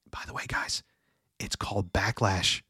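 soft whisper to the audience; whispered aside. Real, not acted. Confiding aside to the viewers.

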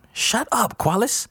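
very high, exasperated order; high-pitched irritation; annoyed hushing. A narrator's reading. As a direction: shut your friend up. Bessie silencing crying Qualis.

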